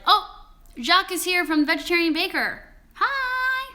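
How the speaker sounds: high, loud delighted squeal of recognition; excited recognition. Retold or imitated. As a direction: gush like spotting a friend on screen. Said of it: "because I was like".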